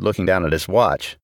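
usual speaking voice

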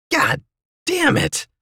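high, strangled yell without much volume; pained yell. A narrator's reading. Narrator with newly broken hand, high pained yell. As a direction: yelp the curse in a very high voice. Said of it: "I yelled".

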